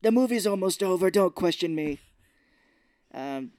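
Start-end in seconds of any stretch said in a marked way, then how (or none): 0.0-2.0 higher, louder cartoon scolding; villain scolding nitpicking listeners; mock angry snap. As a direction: shut them down. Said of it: "He's like".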